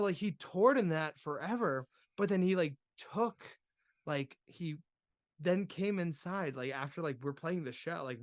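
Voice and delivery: ordinary talk, nothing marked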